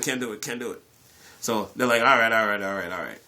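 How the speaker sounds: normal talking voice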